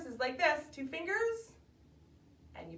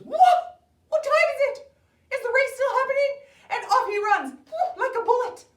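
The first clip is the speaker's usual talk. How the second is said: high, loud panicked yelp; frantic, high-pitched shouting; startled awake; 13 dB louder, faster than usual. Acted. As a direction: burst awake in alarm and race off. Hare wakes in panic.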